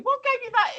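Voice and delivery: sarcastic pretend retort to the shop lad; sarcastic comeback. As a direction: snap back with pretend indignation. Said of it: "I felt like I actually should have said".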